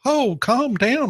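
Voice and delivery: high-pitched, drawn-out telling-off; exasperated hushing. Real, not acted. Host telling news director Russell to settle down, exasperated. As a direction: stretch it out high to calm someone down.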